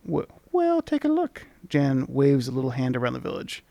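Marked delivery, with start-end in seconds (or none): 0.1-1.5 much higher, squeaky sprite voice; charming tiny creature. Acted. Tiny creature charmingly greeting the traveller. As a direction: chirp it like a tiny fairy. Voicing the mini mite.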